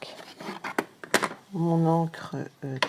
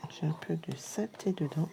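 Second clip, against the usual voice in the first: murmure absorbé dans le travail; chuchoté; 7 dB quieter than usual. Real, not acted. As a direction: souffler les mots sans voix. Nettoie la poudre, concentrée.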